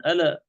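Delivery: "ألا" with an echoed vowel after the l; wrongly bounced lam. Shown as a demonstration. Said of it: "طبعًا هذا خطأ".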